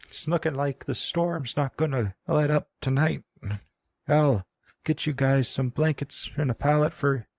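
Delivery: put-on high old woman with an Irish-Scottish twang; high, lilting Irish-Scottish granny; old woman accent. GM voicing the old woman with an Irish-Scottish twang. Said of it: "doing the accent again".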